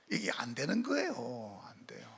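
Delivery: hushed, slow warning whisper. Real, not acted. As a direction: lean in and whisper the danger. Preacher drops to warning whisper.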